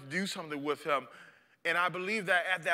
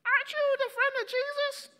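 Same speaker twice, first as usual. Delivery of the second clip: put-on much higher, laughing girlish voice; servant girl. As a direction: tease him like a little girl.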